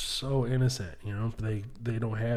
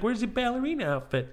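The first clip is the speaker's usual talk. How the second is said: put-on high, curious child's question; innocent little kid; 9 semitones higher than usual. Little boy asking mom about her outfit, innocent kid voice. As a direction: ask it like a naive little boy. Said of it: "I'm so innocent at the time".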